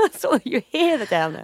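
ordinary talk, nothing marked

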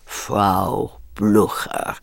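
drawn-out, careful, whispered line; each word weighed, very slowly; menacing slow introduction; very slow and measured. Retold or imitated. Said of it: "said with such measurement".

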